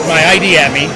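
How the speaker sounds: ordinary delivery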